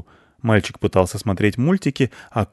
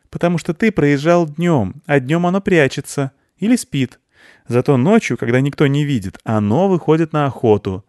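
put-on high, louder child's voice; insistent girl; 6 semitones higher, 4 dB louder than usual. Anya tells boy about the night monster, high and insistent. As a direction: explain insistently in a higher girl's voice.

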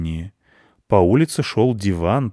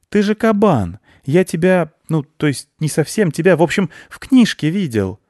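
put-on high, loud eager boy voice; a boy delighted to recognise the beast; bright-eyed childish excitement; excited boy; 9 semitones higher, 5 dB louder than usual.

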